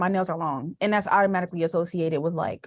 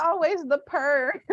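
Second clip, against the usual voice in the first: laughing slow purr; 9 semitones higher, slower than usual. Real, not acted. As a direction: laugh while you say it.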